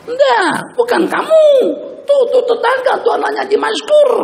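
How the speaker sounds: high, loud shout; exasperated denial. Acted. Parent shouting to the eavesdropping child that it is the neighbour, high and loud.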